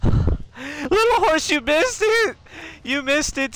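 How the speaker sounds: high, drawn-out excited squeal; very high, worked-up exclaiming. Real, not acted. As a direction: squeal it to a friend who missed the big moment. Tells late viewer about the scare.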